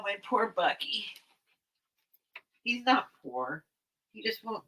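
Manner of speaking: usual speaking voice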